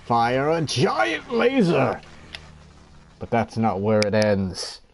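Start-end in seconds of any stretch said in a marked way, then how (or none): 0.1-2.0 big dramatic villain boast, slow; sneering panto villain. Acted. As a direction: ham up the giant laser reveal. Dramatic reveal of big laser.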